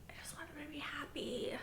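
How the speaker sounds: hushed, breath-only wish; soft, airy little plea; pleading whisper. Real, not acted. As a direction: whisper it, willing the couple on. Pleading to the screen.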